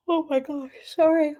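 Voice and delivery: a yawn stretching the words, pitched high. Real, not acted. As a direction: yawn through the apology. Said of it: "why I needed to yawn".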